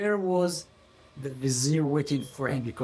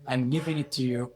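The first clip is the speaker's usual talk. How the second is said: usual speaking voice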